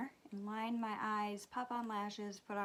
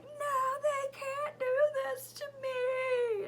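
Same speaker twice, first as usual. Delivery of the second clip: high, loud, drawn-out wail; mock freak-out; 7 dB louder, slower than usual. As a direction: imitate a panicking kid wailing slowly. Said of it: "my child is freaking out".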